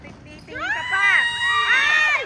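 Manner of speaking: excited sideline shouting. Real, not acted. Parent urging the child to go straight, excited shouting. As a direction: cheer that climbs to a high cry.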